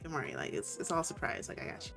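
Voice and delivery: low, hushed, reassuring; soft reassurance. Acted. Acting Adrian reassuring his date.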